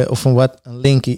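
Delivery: usual speaking voice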